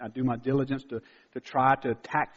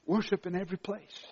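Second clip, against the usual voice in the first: ordinary talk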